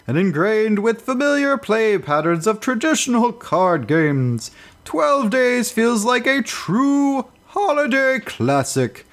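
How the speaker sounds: high, slow jolly Santa voice; booming ho-ho holiday announcer; Santa Claus. Acted. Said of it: "I'm not really Santa Claus".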